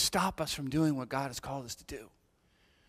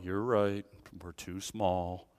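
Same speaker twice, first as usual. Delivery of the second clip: mock defeated Gideon; 8 semitones lower, 5 dB quieter, slower than usual. Gideon voiced, doubting.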